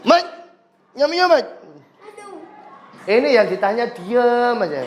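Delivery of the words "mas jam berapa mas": pitched-up, muffled nasal asking; teacher voicing a nasal man asking the time; nasal speech imitation. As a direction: ask the time with blocked-nose speech. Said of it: "orang bindeng tanya".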